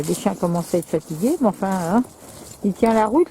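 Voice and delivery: ordinary delivery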